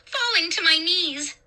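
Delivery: ordinary delivery, nothing marked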